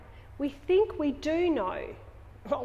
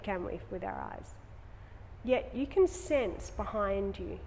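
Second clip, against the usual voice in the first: calm yoga teacher voice guiding the eyes to relax; guided meditation; 3 semitones lower, 4 dB quieter than usual. Acted. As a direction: ease the class into rest behind the eyes.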